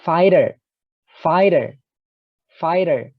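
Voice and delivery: "Fighter" said as faiter, with no g; the gh in "Fighter" left silent. Shown as a demonstration. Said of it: "G-nya tidak dibaca".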